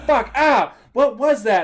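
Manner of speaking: loud pained yelp after a hit. Retold or imitated. Knocked flat by a charging sheep, loud pained yell. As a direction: yelp it out, startled and hurt.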